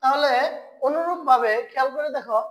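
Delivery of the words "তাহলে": ordinary talk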